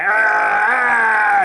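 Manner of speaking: loud echoing monster growl; monster roar. Pretend. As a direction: roar into the echo mic.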